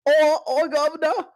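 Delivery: very high mock British voice; hammed-up, high-pitched British impression; British accent. Shown as a demonstration. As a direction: squeal out a silly British accent. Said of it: "this is my British accent".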